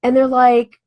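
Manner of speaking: normal talking voice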